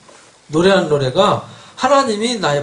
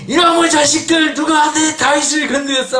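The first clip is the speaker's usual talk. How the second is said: much higher, scolding outburst; furious God; 9 semitones higher than usual. Acted. Preacher voicing God confronting David's enemies, furious. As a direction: burst out in acted divine rage. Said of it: "하나님 막 급하게 화내가지고".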